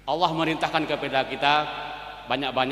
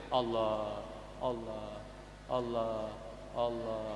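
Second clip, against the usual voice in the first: much lower and softer, fading Allah; fading dhikr; 8 semitones lower, 13 dB quieter, much slower than usual. Shown as a demonstration. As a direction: let each Allah die away to a breath. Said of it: "ya dikecilkan". (zikir makin pelan dan lirih)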